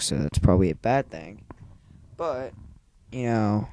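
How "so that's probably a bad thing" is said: usual speaking voice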